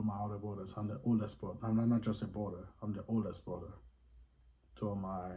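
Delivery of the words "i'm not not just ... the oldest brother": usual speaking voice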